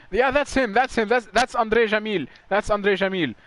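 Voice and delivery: spotting a familiar name, thrilled; excited recognition. Real, not acted. Recognizing a driver on screen.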